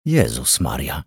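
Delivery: low, groaned exclamation of dismay; moaned. A narrator's reading. Woman in the doorway sees the body, moaning. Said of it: "jęknęła Maryla Detman".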